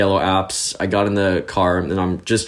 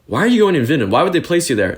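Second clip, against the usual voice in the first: higher-pitched incredulous questions; retold dismay; 6 semitones higher, much faster than usual. Friends warning newcomer about Vidin placement, retold dismay. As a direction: imitate appalled friends.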